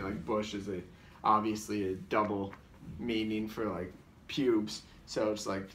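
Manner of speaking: usual speaking voice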